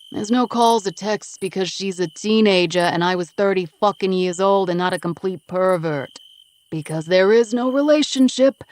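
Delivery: loud, tough street guy voice; gruff East Coast guy Vinny, defensive. Acted. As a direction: tough East Coast smoker brushing her off. Vinny ranting about Connie.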